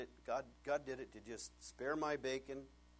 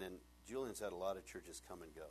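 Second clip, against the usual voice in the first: ordinary talk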